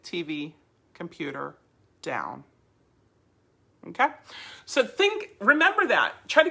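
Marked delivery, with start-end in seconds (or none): 0.0-2.4 T V, COMPUTER, DOWN low and softly even; low, understated list ending on a settled DOWN; just saying it. Shown as a demonstration. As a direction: say DOWN like naming furniture. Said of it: "you could just say it".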